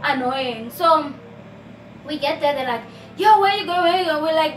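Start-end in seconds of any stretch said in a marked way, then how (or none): 3.2-4.2 mock tout calling out, higher and louder; pushy tout voice. Taxi-rank touts grabbing customers, mock pushy call. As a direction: hustle them like a bus tout. Touts shouting at her family.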